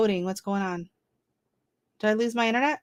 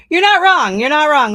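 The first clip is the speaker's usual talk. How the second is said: laughing agreement; 10 semitones higher, 10 dB louder than usual. Real, not acted.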